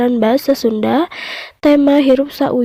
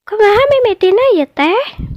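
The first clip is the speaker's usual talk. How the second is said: much higher curious mouse deer voice; mouse deer asking; 10 semitones higher, 4 dB louder than usual. Acted. Mouse deer asks how it all began, high curious voice. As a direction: ask brightly, like a small clever animal. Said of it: "tanya pecang".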